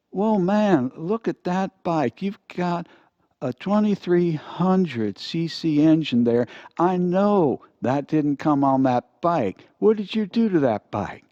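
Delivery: high, eager admiration of a motorcycle; excited biker talk. Acted. Big Billy Bob admires the motorcycle.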